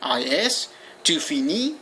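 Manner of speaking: usual speaking voice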